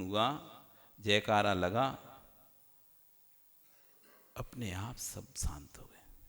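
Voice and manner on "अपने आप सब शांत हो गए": slow, breathy whisper to show silence; whispered hush. Shown as a demonstration. The preacher whispers to show the hall falling silent, hushed. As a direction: let the words fade to a whisper.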